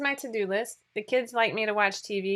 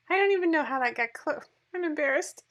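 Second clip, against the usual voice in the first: embarrassed; 6 semitones higher than usual. Real, not acted. Embarrassed by caption joke.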